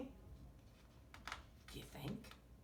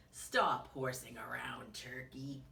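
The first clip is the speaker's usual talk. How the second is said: slow, low cow voice; talking cow; 7 semitones lower than usual. A narrator's reading. Cow scolding Turkey for horsing around, slow low voice. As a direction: play a cow, low and unhurried. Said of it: "said the cow".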